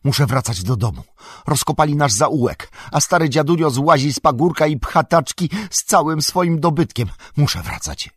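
high, loud, upset outburst easing at the end; agitated outcry. A narrator's reading. Sam sees his old dad evicted and must go home, agitated outcry. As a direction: call out urgently, pitch climbing. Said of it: "zawołał wzburzony".

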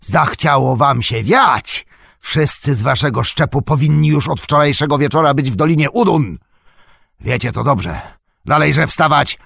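high, loud threatening tirade; angry orc snarl. A narrator's reading. Orc driver ordering deserters back into line, snarling loudly. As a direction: berate them like a brutal slave-driver. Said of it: "warknął".

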